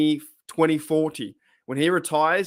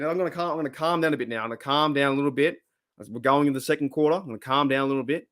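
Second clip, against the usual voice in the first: fast excited talk; much faster than usual. Real, not acted. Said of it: "I'm pretty fired up".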